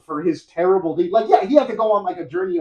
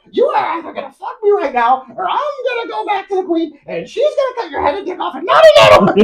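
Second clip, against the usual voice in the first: shrieking, much higher, loud threat; furious threat; 14 semitones higher, 6 dB louder than usual. Retold or imitated. Old woman threatening the knight, high furious shout. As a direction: scream the ultimatum at him, pitched way up.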